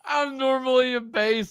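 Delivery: high head voice, laughing; joking falsetto showing off a head voice. Shown as a demonstration. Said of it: "That's my high voice".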